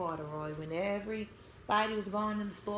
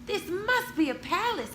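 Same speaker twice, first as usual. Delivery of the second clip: higher, louder awed bear; amazed gasp; 9 semitones higher, 6 dB louder than usual. Acted. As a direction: gasp at the sight of a palace. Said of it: "Corduroy gasped".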